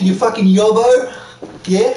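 badly faked, hammed-up Australian accent; attempted Australian accent. Trying an Australian accent for a friend, hammed-up Australian. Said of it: "can't even do an Australian accent".

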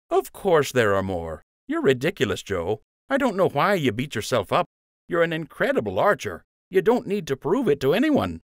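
cheeky loyal sidekick; fond teasing friend. A narrator's reading. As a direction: rib him like an old pal. Lund voiced by narrator.

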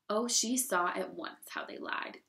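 ordinary delivery

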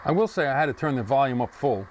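usual speaking voice

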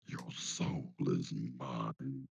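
very deep, slow, soft demon growl. Retold or imitated. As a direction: claim her soul like a demon. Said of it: "talk in the demon voice".

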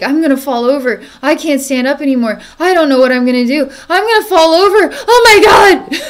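rising from anxious to a high, loud frantic cry; mock hysterical tree, building to a shriek; panicking worry wart. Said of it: "we have a worry wart".

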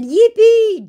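high, loud mock cheer; sarcastic yippee. Mocking the scammer with a shrill fake cheer. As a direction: fake delight.

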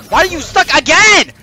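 loud, rising frustration; exasperated yelling. Real, not acted. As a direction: build to a high, loud outburst. Exasperated during play.

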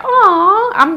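high, loud touched aw. Real, not acted. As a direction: coo it, touched. Reacting to a compliment on daughter's hair.

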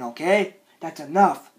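low and soft; subdued warning. Acted. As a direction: quietly lay down the limit. Subdued warning after yelling.

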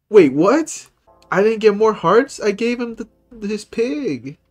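surprised. Real, not acted. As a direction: react in shock. Shocked at game result.

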